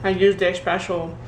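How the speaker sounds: talking with a mouth full of steak. Real, not acted. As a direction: speak around a mouthful of food. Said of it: "sorry for talking with my mouth full".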